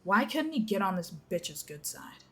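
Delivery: plain reading voice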